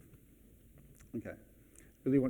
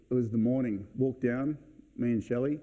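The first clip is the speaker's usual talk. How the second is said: usual speaking voice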